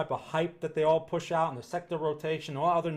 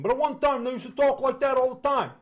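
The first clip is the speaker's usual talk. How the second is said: put-on higher, louder old-school Brooklyn accent; 8 semitones higher, 7 dB louder than usual. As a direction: ham up a classic Brooklyn guy. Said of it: "They don't talk like that anymore in Brooklyn".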